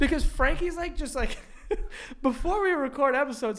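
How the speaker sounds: laughing through the words; giggling nervously while talking. Real, not acted. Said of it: "I'm having a nervous laugh reaction".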